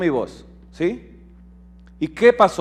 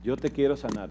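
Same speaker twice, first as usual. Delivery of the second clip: "Yo te quiero sanar" repeated much softer, almost breathed; hushed echo; 5 semitones lower, 11 dB quieter than usual. Acted. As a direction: let the promise fall to a hush. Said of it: "dice el Señor".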